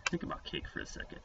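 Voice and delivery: breathy near-whisper, savoring each cake; dreamy hush. Pretend. Teacher hushes to make kids imagine cake, dreamy whisper.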